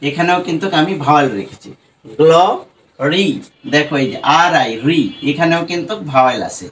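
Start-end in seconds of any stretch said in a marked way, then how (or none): 2.2-3.3 গ্ল, pause, then রি, each on its own; broken into syllables. Shown as a demonstration. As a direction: sound out গ্ল and রি as separate syllables. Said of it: "ভেঙে ভেঙে উচ্চারণ করি".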